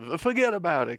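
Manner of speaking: thick, high, loud Italian-American New York imitation; Italian New York accent. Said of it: "Italian New York City accent".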